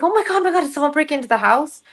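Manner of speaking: high-pitched, hurried alarm; retold panic. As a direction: relive the fright of a possible break-in. Retold alarm at suspected break-in.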